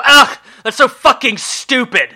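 loud, high, drawn-out disgust; exasperated outburst. Retold or imitated. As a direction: blurt it out, fed up and scornful. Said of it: "and you'll go".

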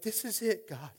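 high, overcome cry to God; retold bawling. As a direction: imitate being moved to tears. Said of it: "I'm bawling my eyes out".

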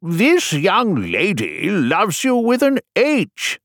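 high, very slow swooping voice; high-pitched, drawn-out storybook king; flustered king. Acted. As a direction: draw it out in a high kingly wobble.

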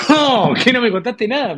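amused disbelief. Real, not acted. Friend hears he was never told, amused high-pitched protest. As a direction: burst out in laughing disbelief. Laughing shock at friend's confession.

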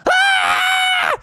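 very high, loud scream; terrified scream. Acted. Froggy sees ghost chasing him, high scream. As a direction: scream suddenly at the top of your range. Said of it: "And he went".

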